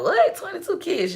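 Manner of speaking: mock shock at the number; imitating viewers hearing about twenty-two kids, shocked. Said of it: "Y'all be like".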